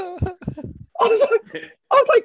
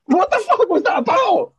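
exasperated outburst; 11 semitones higher than usual. Real, not acted. Retelling the flight attendant story, exasperated high outburst. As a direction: throw it up in disbelief.

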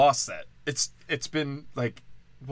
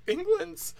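squeaky, pitched way up mock panic; mock panicking Brits. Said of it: "They're like".

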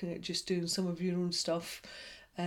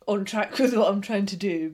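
ordinary talk, nothing marked

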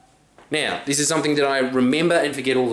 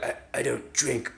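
mumbled refusal; 9 dB quieter than usual. Retold or imitated. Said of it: "they're just being like".

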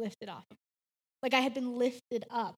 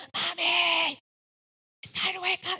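put-on a small child's loud half-whisper, higher; imitating son's whisper-scream; 4 semitones higher, 4 dB louder than usual. Mom imitates her son waking her, a mock whisper-scream. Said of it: "whisper screaming at me".

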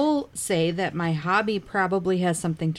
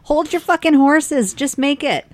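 raised, loud comeback to a nagging passenger; acted irritated retort; 10 semitones higher, 7 dB louder than usual. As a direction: fire back loudly in character, pitched up. Driver snaps back in character.

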